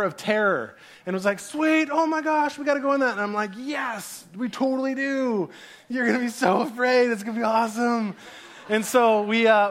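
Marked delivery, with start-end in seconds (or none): 1.4-3.1 high-pitched imitation of a thrilled child; squeal-free, high, eager kid impression; excited young daughter. Five-year-old spots the Tower of Terror, excited imitation. 3.6-8.2 higher-pitched retold enthusiasm; excited dad. Dad agreeing to ride with his daughter, playfully excited.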